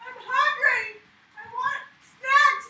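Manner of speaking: real tantrum whine; whiny wail. Real, not acted. Whining mid-breakdown.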